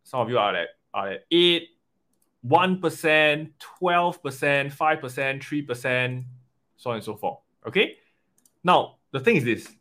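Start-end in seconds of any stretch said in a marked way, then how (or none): none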